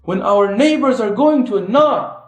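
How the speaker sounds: rising, driving sermon voice; impassioned preaching. Acted. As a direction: rouse the congregation to action. Preaching call to da'wah.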